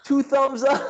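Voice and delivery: catchphrase said through laughter; laughing catchphrase. Retold or imitated. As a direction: laugh while saying it.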